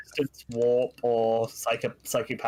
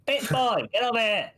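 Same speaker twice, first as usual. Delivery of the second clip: high-pitched jeering summons; taunting call; 9 semitones higher than usual. Acted. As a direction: call out the coward in hiding. Demon head calling out hidden man.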